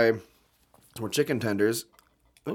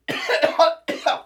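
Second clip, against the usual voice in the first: coughing fit; 12 semitones higher than usual. Real, not acted. Choking on mustard.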